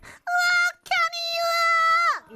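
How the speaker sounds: put-on very high, loud, slow little-girl call; lolly voice. Shown as a demonstration. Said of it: "I like to do the lolly voice".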